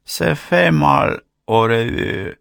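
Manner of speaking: high, slow wince of a French teacher; lightly voiced teacher, pained and drawn out; mild mock teacher wincing at bad French; a French teacher's pained sigh. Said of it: "говорила мадам Фурнье".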